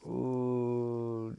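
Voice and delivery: long, drawn-out squeamish ooh; uneasy boy. Acted. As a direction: hold the sound out, wary. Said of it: "And Jordan goes".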